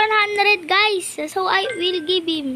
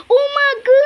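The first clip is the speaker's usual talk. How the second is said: loud call; 8 semitones higher, 9 dB louder than usual. Real, not acted. Shouting a greeting to another player.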